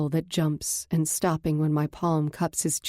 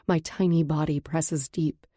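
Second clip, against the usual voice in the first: normal talking voice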